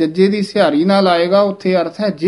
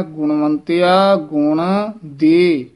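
slow ਗੁਣਵੰਤਿਆ ਗੁਣ, pause, then ਦੇ; separate gun and de; much slower than usual. Shown as a demonstration. Teacher demonstrates splitting ਗੁਣ from ਦੇ, slow. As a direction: keep ਗੁਣ and ਦੇ apart, slowly. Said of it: "ਇਹ ਵੀ ਗੁਣ ਦੇ ਇਕੱਠਾ ਨਹੀਂ ਬੋਲਣਾ ਹੈ".

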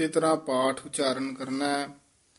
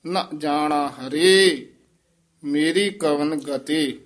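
slow, with ਹਰੇ falling and a pause before ਮੇਰੀ ਕਵਣ ਗਤੇ; ਹਰੇ ਤੇ ਵਿਸਰਾਮ, ਹੌਲੀ; pause after ਹਰੇ; 4 dB louder, slower than usual. Shown as a demonstration. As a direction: rest on ਹਰੇ, then go on slowly. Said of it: "ਹਰੇ ਪਦ ਤੇ ਵਿਸਰਾਮ ਲਾਉਣਾ ਹੈ".

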